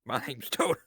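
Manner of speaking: imitating Toto. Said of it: "it's a good impression".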